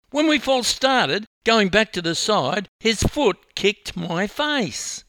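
high, loud, aggrieved account; wailing complaint. A narrator's reading. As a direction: protest the injury in a high wail. Swimmer's upset complaint continues.

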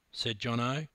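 ordinary delivery, nothing marked

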